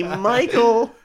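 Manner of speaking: high, strange put-on voice calling the name; as a pushy wedding photographer, sing out the name; odd photographer voice. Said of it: "I don't know why he sounds like that".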